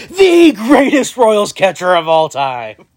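slow, hammed-up proclamation; mock grand praise. Hyping a mediocre catcher to friends, slow mock-grand. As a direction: oversell it like a hall-of-fame speech. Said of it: "I can't even say that with a straight face".

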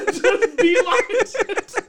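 slow words broken by high laughter; can barely get it out for laughing; laughing hard. Real, not acted. Friend cracking up at the story's punchline.

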